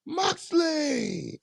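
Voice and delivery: arena introduction with big held vowel; Justin Roberts announcing. Retold or imitated. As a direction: stretch the name like a ring announcer. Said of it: "and Justin Roberts goes".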